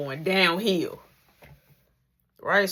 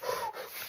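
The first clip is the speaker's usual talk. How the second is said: mock sob; 13 dB quieter than usual. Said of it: "So he like".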